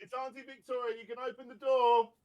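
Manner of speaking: high-pitched call to another room. Real, not acted. Parent telling kids to open the door, calling out.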